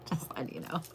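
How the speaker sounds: soft breathy whisper of just letting you know; whispered catchphrase. Shown as a demonstration. Copying a creator's catchphrase, whispered with a laugh. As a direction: whisper the phrase with a little laugh. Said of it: "wanting to say things like he says".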